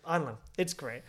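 ordinary talk

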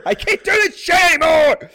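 hammed-up high shout; imitated meltdown at the fridge; mock yelling. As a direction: bellow it in exaggerated exasperation. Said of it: "yell at, at appliances around your house".

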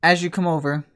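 normal talking voice

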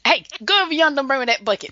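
broad North Carolina backyard accent; backyard Southern talk. Shown as a demonstration. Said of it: "That's how you talk when you're in the backyard".

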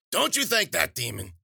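high, loud call from another room. A narrator's reading. Witch calling from the kitchen at Wheeler, loud high call. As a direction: shout it from the kitchen. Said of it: "the witch cried from the kitchen".

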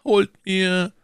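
ordinary delivery

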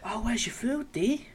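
mocking friends. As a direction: tease a friend who lost out. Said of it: "And they're all laughing".

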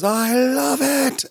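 "I love it" very high and very slow, pauses stretched; stressing the pauses. Retold or imitated. Rue directing the queens, a very high, slow drawn-out line. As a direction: hold "I love it" out slowly, way up high. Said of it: "Rue does the thing where he goes".

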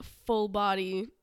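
normal talking voice

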